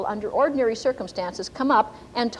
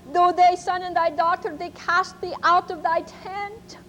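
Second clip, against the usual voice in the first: put-on German accent; 6 semitones higher than usual. Ma repeats the prophecy to the congregation, German accent. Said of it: "kind of a German accent".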